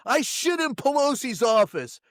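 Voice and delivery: proudly crowing about it; boastful rioter. Retold or imitated. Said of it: "they're coming out".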